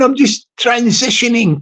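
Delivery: ordinary talk, nothing marked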